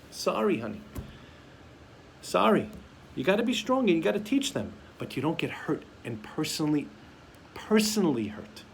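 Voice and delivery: ordinary talk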